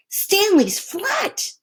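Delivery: high, slow stretched-out cry; a child stunned by what he sees; wide-eyed kid's exclaim; amazed boy. A narrator's reading. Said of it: "said Arthur".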